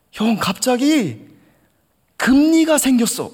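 high loud shout, slow start; yelling hoobae. Retold or imitated. Junior shouting about his sudden healing, high loud yell. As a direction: shout the miracle in wonder. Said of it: "고래고래 소리를 지르더라고요".

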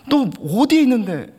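high loud call; alarmed question. Retold or imitated. Older brother asking his junior where he is, high loud call. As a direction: ask again where he is, alarmed. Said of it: "했는데".

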